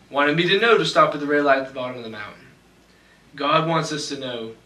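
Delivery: normal talking voice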